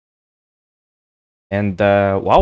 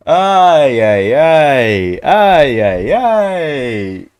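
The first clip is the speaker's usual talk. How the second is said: laughing groan; 5 dB louder, much slower than usual. Real, not acted. Laughing at the closed account.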